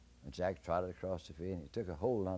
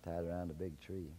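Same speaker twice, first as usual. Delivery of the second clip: plain reading voice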